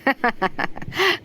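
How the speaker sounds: high, short chuckle; light burst of laughter; amused laugh. Acted. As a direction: laugh off your troubles. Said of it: "رحيم بضحكة".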